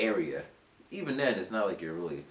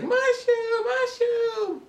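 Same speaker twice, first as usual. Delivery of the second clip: high, loud shout of the name; high loud excited call; 5 dB louder, much slower than usual. Retold or imitated. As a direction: call out in delighted surprise. Students calling out to him.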